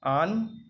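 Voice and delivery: आन said high and loud; first syllable stressed. Shown as a demonstration. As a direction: hit आन hard, then pause. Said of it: "और फिर सांगिक".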